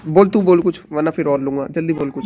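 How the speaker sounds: loud, insistent prodding to speak up; loud teasing demand at the dinner table; loud urging. Real, not acted. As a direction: push him to talk, loud and impatient. Pressing family member to taste.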